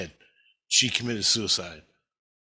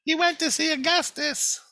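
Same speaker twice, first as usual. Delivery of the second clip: much higher, faraway woman's voice calling up; high-pitched Tina voice. Retold or imitated. Tina calling up from far below, high and distant. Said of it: "down below going".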